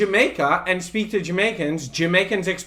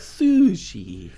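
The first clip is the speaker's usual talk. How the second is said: drawn-out "Sushi" in a put-on Japanese accent; joking, exaggerated Japanese accent; mock Japanese accent. Shown as a demonstration. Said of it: "say sushi without the accent".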